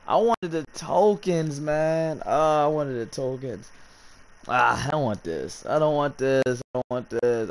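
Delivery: slow, drawn-out whine of disappointment; disappointed whining. Real, not acted. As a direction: moan about it, dragging the words. Whining after losing the tokens.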